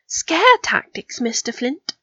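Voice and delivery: high, drawn-out, teasing delight; slow, arch and high-pitched; amused Pansy. A narrator's reading. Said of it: "Pansy chuckled appreciatively".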